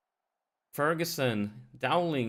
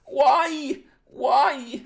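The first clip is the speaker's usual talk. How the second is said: high, loud wail of despair; anguished shouting; 13 semitones higher, 10 dB louder than usual. Real, not acted. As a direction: cry it out in agony at the miss. Anguish at missed chance.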